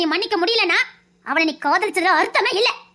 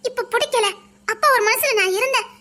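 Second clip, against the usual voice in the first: ordinary delivery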